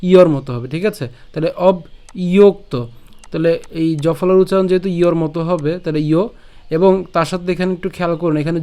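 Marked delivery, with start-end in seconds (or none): 1.7-2.7 "অব ইয়োক্ত" in two parts, pausing after অব; teacher's syllable-by-syllable "অব ইয়োক্ত"; broken-down pronunciation. Shown as a demonstration. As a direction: split it as অব, then ইয়োক্ত. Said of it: "অব পর্যন্ত উচ্চারণ করছি".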